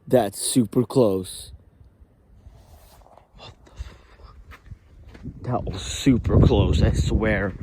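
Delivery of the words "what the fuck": breathless whispered curse; startled whisper. Real, not acted. Vlogger hearing a noise close by, startled whisper. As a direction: gasp it out under your breath.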